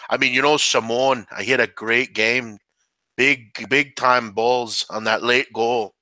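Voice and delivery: slow mock-Canadian postgame interview; Canadian accent. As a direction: stretch it out like a Canadian hockey player. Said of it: "that's your Canadian accent".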